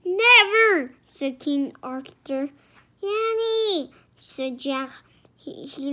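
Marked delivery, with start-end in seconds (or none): 0.0-1.0 loud, high shout; emphatic refusal. A narrator's reading. King Arthur forbidding the quest, shouting. 3.0-3.9 loud, high, urgent call. A narrator's reading. Jack trying to stop Annie, calling out.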